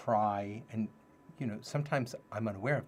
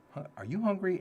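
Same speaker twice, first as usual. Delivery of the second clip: higher, louder sing-song parent voice; talking to toddler; 10 semitones higher, 6 dB louder than usual. Retold or imitated. New dad asking his toddler daughter, higher sing-song voice. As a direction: coax a little girl gently.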